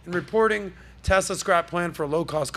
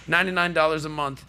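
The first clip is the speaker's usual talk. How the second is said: normal talking voice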